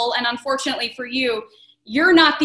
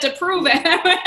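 laughing; 6 semitones higher, much slower than usual. Real, not acted. Host laughing while quoting.

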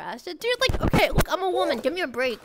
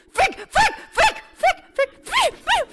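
panicked; 11 semitones higher, 4 dB louder, slower than usual. Real, not acted. Panics at being in China.

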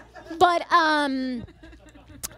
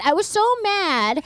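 normal talking voice

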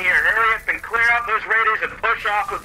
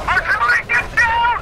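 high urgent yell; panicked under fire; 13 semitones higher than usual. Acted. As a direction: shout for everyone to hit the deck. Artillery incoming, warning squadmates.

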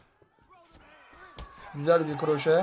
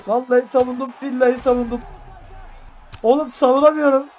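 rising, louder panicked protest; exasperated shout; 6 semitones higher, 6 dB louder than usual. Real, not acted. Gamer yelling at the screen, panicked shout. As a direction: swear you defended, voice climbing high. Defending in panic mid-fight.

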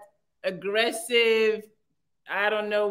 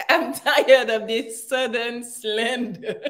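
laughingly imitating his complaint; tickled mock quote, giggling; amused mimicry. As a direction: repeat his words through laughter. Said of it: "He said".

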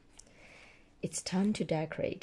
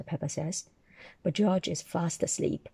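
usual speaking voice

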